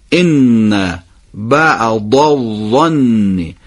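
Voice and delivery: very slow, ض in بعض and ظ in الظن each said distinctly; eloquent Arabic. Shown as a demonstration. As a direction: give بعض a true ض and الظن a true ظ, slowly. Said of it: "هر دوتا باید صحیح ادا بشه با تلفظ فصیح". (تلفظ فصیح عربی ض و ظ)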